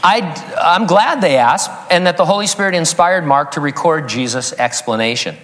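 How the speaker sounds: ordinary talk, nothing marked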